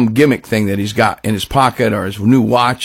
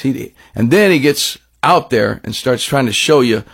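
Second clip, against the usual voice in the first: ordinary talk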